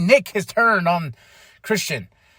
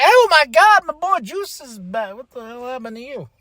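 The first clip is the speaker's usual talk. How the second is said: shocked reaction; 6 semitones higher than usual. Retold or imitated. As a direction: act stunned to see an old friend changed. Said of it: "I was like".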